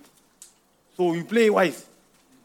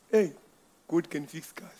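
quiet self-talk; 5 semitones lower, 9 dB quieter than usual. Retold or imitated. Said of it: "I'm telling myself".

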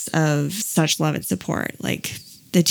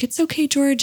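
put-on higher, sweet little girls soothing; sweet girls' voice; 9 semitones higher than usual. Schoolgirls comforting George, sweet and high. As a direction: coo it like kind schoolgirls.

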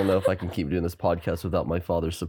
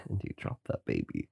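low, softer, breathy aside; whispering; 11 semitones lower, 10 dB quieter than usual. Acted. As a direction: murmur it like a secret. Whispered aside about the show.

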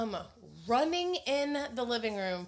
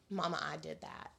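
put-on baby talk; 4 semitones lower, 9 dB quieter than usual. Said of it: "you still sounded kinda like a baby".

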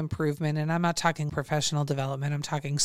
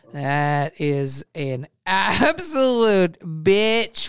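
laughing drawn-out emphasis; 3 semitones higher, 5 dB louder, much slower than usual. Real, not acted. Laughing about hard online course.

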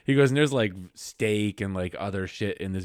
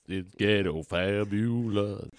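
sassy drawn-out; slower than usual. Pretend. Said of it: "repeats with flair".

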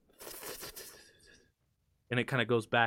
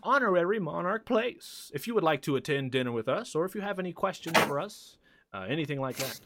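lightly higher, polite host voice; genial host NPC; 6 semitones higher than usual. Acted. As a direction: invite the winner to dinner. GM plays Mister Witch.

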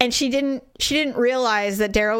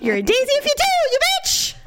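gunslinger taunt; much faster than usual. Acted. Wife quoting a western at the shopper, hammed-up. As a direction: throw it down like a film gunfighter.

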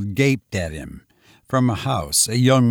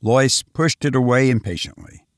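ordinary delivery

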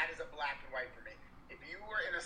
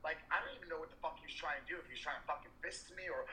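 usual speaking voice

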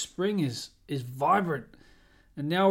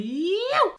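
a sudden, very high cheerful yeew; high whoop; 5 dB louder than usual. Real, not acted. Host cheering his listeners on with a high whoop. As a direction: whoop it out like a celebration. Cheering after preaching about enjoying life.

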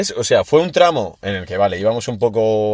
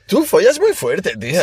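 higher, slow teasing retelling; mock quote; 6 semitones higher, slower than usual. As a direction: drag it out in a high mock voice. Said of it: "le dijiste a Marcos".

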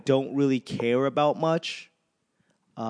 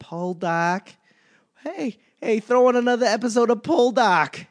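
put-on loud, high Boston accent, calling for more; a Boston guy hollering for another episode; 9 semitones higher, 5 dB louder than usual. Asking for more Poldark, in a loud Boston accent. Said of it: "that Boston accent shows up".

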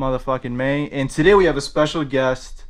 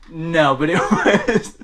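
laughing; 5 semitones higher, 5 dB louder, slower than usual. Real, not acted. Laughing at debate memory.

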